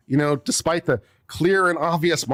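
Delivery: usual speaking voice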